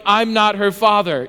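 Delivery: normal talking voice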